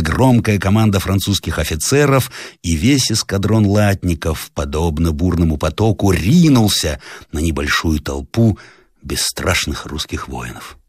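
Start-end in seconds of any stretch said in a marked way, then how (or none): none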